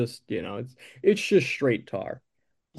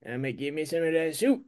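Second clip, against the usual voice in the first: put-on quick, softer old New England accent; old New England man; 7 dB quieter, faster than usual. Shown as a demonstration. [name]'s soup threat in an old New England accent. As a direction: mumble it like an old New England pipe smoker. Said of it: "He talks like an old New England man".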